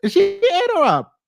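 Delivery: high, delighted shriek; gleeful high cry of approval; excited squeal. Real, not acted. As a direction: she ate her up, thrilled. Thrilled by the diss.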